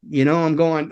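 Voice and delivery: ordinary talk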